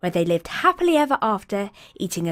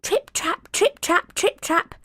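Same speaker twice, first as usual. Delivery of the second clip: light hoof steps; 10 semitones higher, slower than usual. A narrator's reading. Youngest goat's little hooves crossing the bridge, high and light. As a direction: tap it out like tiny hooves.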